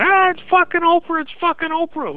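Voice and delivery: high-pitched hammed-up squeal; loud falsetto wail, easing off in volume; mock crying fans. Caller mimics audience women crying as Oprah walks out, mock wailing. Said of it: "They start crying".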